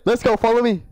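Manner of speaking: ordinary talk, nothing marked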